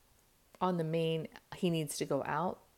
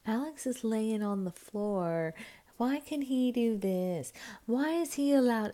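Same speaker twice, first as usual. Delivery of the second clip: put-on rising, slow whiny kid voice; whiny complaint; 6 semitones higher, 3 dB louder, slower than usual. Daughter complaining to parents about brother, whiny and rising.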